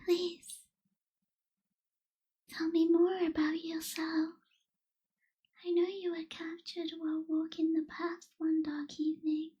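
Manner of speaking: normal talking voice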